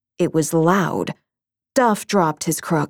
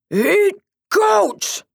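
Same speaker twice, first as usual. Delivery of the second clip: hollering monster; 13 semitones higher than usual. A narrator's reading. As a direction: bellow it like a hungry monster. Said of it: "hollered the big blue monster".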